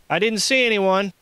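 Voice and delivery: shouted reply. A narrator's reading. Josh answering the guard's question, shouting.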